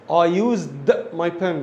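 I used my pen very slowly; teacher dictating slowly; slow dictation. Shown as a demonstration. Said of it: "model sentence".

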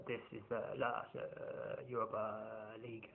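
soft, breathy manager impression with dragged-out uhs; badly faked football manager, hushed and halting; Andrew Villas-Boas. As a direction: murmur it slowly, hesitating between phrases. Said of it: "I can't do the impression of Andrew Villas-Boas".

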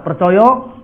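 normal talking voice

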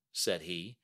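ordinary delivery, nothing marked

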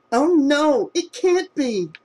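stunned as she recognises him; girl seeing her ex on the floor, horrified; horrified shock. A narrator's reading. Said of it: "she said".

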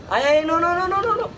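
panicked high-pitched no's; desperate wail as he's caught; being chased. Real, not acted. About to die in game. (lamento desesperado, muy agudo)

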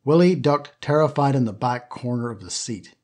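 plain reading voice

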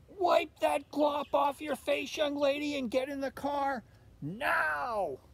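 high, loud, slow scolding shout; mother shouting at her daughter to stop; angry mother. A narrator's reading. Angry mother orders Olivia.

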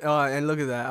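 ordinary talk, nothing marked

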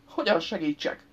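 ordinary delivery

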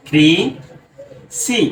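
ক্রি, সি with a long gap between; two isolated syllables, ক্রি and সি; broken into syllables. Shown as a demonstration. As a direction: sound out ক্রি, সি one at a time. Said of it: "এটাকে যদি আমরা ভাঙি".

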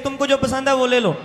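ordinary talk, nothing marked

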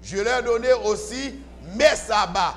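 ordinary talk

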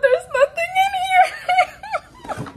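very high, loud squeal collapsing into laughter; laughing despite upset. Real, not acted. Revealing the empty box to viewers, laughing despite upset. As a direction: squeal it, then crack up helplessly. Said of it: "I don't know why I'm laughing".

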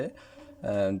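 normal talking voice

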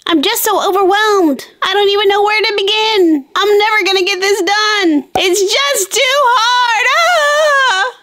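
high-pitched whine building to a cry; despairing, hammed-up wail that peaks in a howl; overwhelmed wail. Girl overwhelmed by packing, wailing that it's too hard.

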